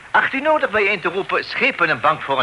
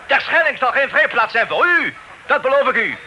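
much higher, heated threat; indignant outburst; 8 semitones higher than usual. Acted. Amsterdam officer threatening the stubborn drost, heated. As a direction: flare up and promise revenge.

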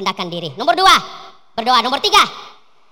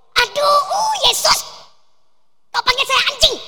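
wounded outcry; 9 semitones higher than usual. Acted. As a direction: cry out hurt at the insult. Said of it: "Perempuan itu".

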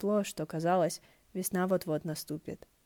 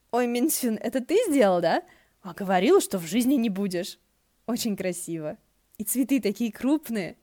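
delighted; 4 semitones higher, 6 dB louder than usual. Acted. Delighted by blooming plum.

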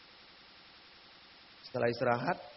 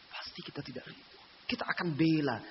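normal talking voice

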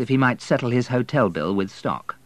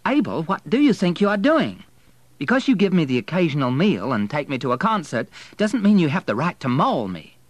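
high, loud, affronted scolding; indignant rebuke; 8 semitones higher, 6 dB louder than usual. A narrator's reading. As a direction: tell off an overeager suitor, pitch raised. Woman rebuffs unwanted kiss, indignant.

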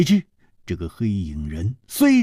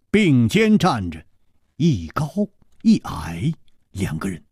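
usual speaking voice

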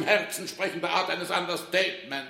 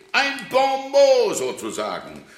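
smug pun; 7 semitones higher, 5 dB louder, slower than usual. Acted. Director pleased with his joke.

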